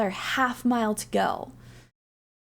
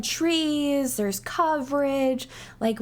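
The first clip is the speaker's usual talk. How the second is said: usual speaking voice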